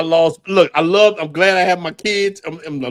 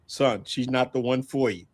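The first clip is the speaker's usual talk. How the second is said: low, soft and grave; solemn fatherly warning; 11 semitones lower, 9 dB quieter than usual. Retold or imitated. Telling his son in the garden he must let her go, in a low grave voice. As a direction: lower and softer, like a wise old father.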